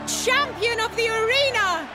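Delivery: grand proclamation. Acted. Patron proclaims champion at tourney.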